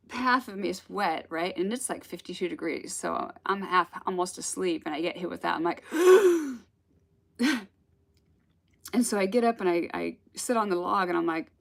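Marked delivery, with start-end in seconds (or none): 5.9-6.6 sharp shocked gasp. Retold or imitated. Hit by cold water, shocked.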